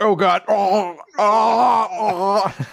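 mock straining groan, like laying an egg; hammed-up groaning effort. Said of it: "He's just like".

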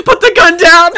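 high, loud comic alarm; hammed-up hostage plea; mock panic. [name] to the gunman holding him up, mock panic. [name] facing the imagined gun.

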